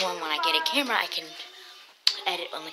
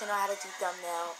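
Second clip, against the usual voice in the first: ordinary talk, nothing marked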